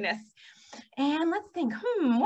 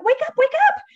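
high, loud, urgent wake-up call; excited storybook shout, pitched way up; cried out; 11 dB louder, faster than usual. A narrator's reading. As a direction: rouse a heavy sleeper. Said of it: "cried Ladybug".

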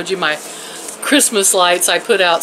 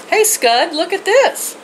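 calling the dog; 10 semitones higher than usual. Real, not acted. Calling her dog to look.

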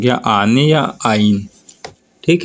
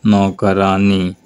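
slow demo word; much slower than usual. Shown as a demonstration. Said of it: "नौकर के".

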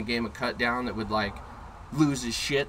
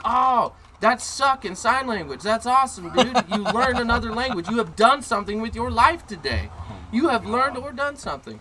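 mock praise; 9 semitones higher, 6 dB louder than usual. Man mocking a kid's rude gesture, loud sarcastic praise. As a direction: cheer him on with heavy sarcasm.

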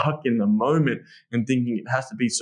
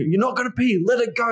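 much higher and louder, reassuring push; urging; 11 semitones higher, 3 dB louder than usual. Shown as a demonstration. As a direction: keep her going. Reassuring partner at climax.

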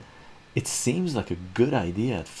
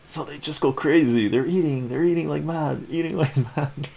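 excited; 7 semitones higher than usual. Acted. Vlogger describes family eating wildly, excited and laughing. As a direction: rush it like a frenzy.

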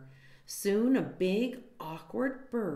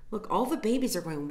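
plain reading voice

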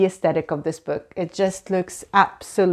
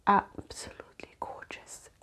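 softer hushed whisper; awed whisper; 11 dB quieter than usual. Real, not acted. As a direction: breathe it out in awe. Hushed admiration.

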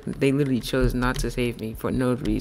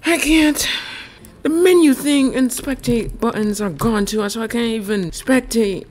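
much higher, exasperated; high frustrated wail; 10 semitones higher than usual. Real, not acted. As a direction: complain in despair. Frustrated stuck.

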